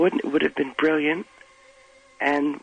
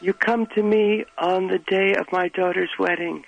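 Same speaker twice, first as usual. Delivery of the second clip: put-on Godfather-style Italian accent, slowed; 3 semitones higher than usual. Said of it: "doing a stereotypical Italian accent".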